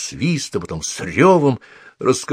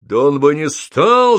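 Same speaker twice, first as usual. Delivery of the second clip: louder, higher retort, drawn out; yelling back; 5 semitones higher, 5 dB louder than usual. A narrator's reading. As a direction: yell back over her. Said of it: "завопил в ответ Холл". (вопить в ответ)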